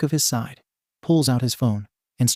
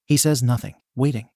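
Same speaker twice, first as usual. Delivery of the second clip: plain reading voice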